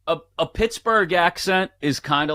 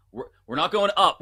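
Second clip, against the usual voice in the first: usual speaking voice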